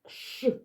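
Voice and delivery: Ш as a bare hushing consonant; isolated SH sound. Shown as a demonstration. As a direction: say only the Ш sound, with no clear vowel. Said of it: "Давайте його вимовимо".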